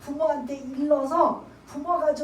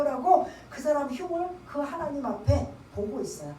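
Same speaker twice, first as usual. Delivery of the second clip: usual speaking voice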